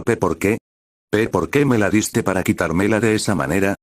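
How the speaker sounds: usual speaking voice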